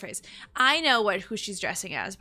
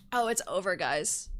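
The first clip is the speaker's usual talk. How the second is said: ordinary talk, nothing marked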